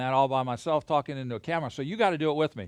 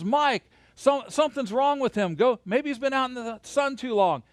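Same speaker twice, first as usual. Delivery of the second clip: calling out; 7 semitones higher than usual. Retold or imitated. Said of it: "He goes".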